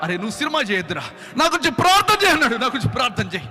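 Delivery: a sobbing friend shouting for prayer; crying plea. Retold or imitated. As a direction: beg him to pray, half shouting. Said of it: "అని ఏడుస్తా ఉన్నాడు".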